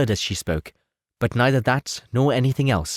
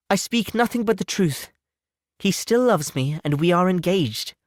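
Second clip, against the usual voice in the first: put-on much higher young lady pleading to be believed; earnest Elizabeth; 11 semitones higher than usual. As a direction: sister insisting it's the truth. Elizabeth insists she is earnest.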